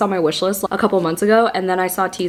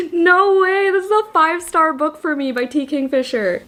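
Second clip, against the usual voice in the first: excited; 7 semitones higher than usual. Real, not acted. Thrilled at book item.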